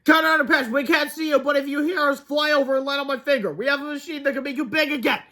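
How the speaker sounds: acted shouting into the distance; loud calling. A narrator's reading. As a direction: call out to someone out of sight. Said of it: "He called as loud as he can".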